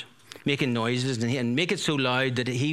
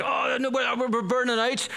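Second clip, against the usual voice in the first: high, mock panic, much higher; panicked foolish virgins; 11 semitones higher than usual. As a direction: squeal it like someone caught unprepared. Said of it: "I picture them going like".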